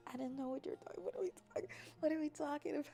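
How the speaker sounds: ordinary talk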